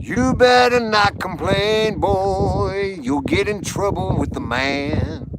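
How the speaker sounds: slow, high drawn-out threat; mock stern warning. Teasing the egg champion with a slow mock warning. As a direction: play a mock lawman warning the boy.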